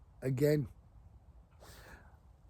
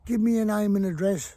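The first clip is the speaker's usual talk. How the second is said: calling across water; 7 semitones higher, 6 dB louder than usual. Retold or imitated. Lad calling across the river.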